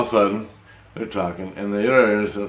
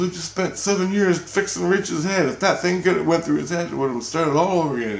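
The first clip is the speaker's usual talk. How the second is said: Jesus scolding; 9 semitones higher than usual. Retold or imitated. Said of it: "He goes".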